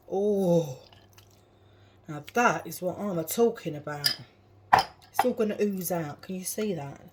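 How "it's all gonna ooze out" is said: ordinary talk, nothing marked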